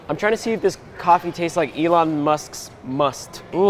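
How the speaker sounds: usual speaking voice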